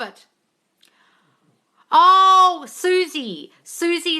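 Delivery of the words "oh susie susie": a loud, high burst of recognition; light up as you recognise the name; delighted recognition. Real, not acted. Recognising a YouTuber a viewer named, delighted and loud.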